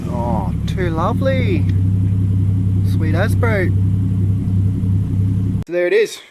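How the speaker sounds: delighted, loud and high; thrilled the engine fired up; excited. Real, not acted. Car starts on first test.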